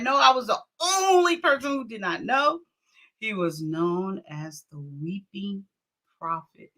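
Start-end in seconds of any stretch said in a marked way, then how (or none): 3.2-6.7 much softer, dropped low for the punchline; hushed reveal. Acted. Vlogger reveals her son's name meant weeping, hushed and low. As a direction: let the reveal sink, low and quiet.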